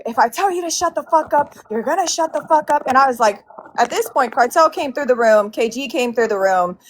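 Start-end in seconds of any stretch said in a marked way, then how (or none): 0.1-2.8 retold scream. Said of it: "he starts screaming at me loud as fuck".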